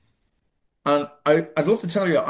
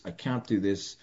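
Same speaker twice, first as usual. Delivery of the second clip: quiet despairing mutter; 6 semitones lower, 7 dB quieter than usual. Retold or imitated. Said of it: "talking to myself going".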